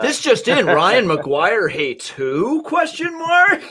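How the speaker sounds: high-pitched, drawn-out tabloid headline; mock newscaster. Host teases guest with breaking clickbait headline, mock newscaster. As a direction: tease with a fake breaking-news bulletin.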